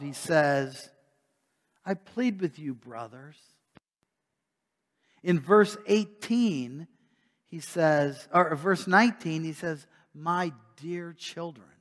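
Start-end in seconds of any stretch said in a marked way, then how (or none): 1.9-3.4 softer, gentle plea, fading out; softer pleading. Retold or imitated. Paul pleading softly with his brothers, tender. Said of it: "his tone is softer". 10.1-11.7 softer, slow, tender address; tender pastor. Retold or imitated. Pastor addressing his flock, soft and tender. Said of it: "with the heart of a pastor".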